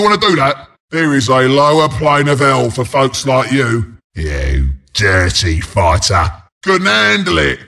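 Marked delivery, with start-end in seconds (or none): 4.1-6.3 very deep, slow drawl; low relishing growl. Acted. Announcer to a foul fighter, low slow growl. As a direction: draw it out right at the bottom of the voice.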